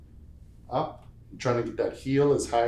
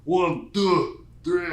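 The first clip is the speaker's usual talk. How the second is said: counting in a put-on Austrian-German accent, higher and louder; Arnold's German accent; 7 semitones higher, 5 dB louder, much slower than usual. Shown as a demonstration. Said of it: "like Arnold, like that stuff".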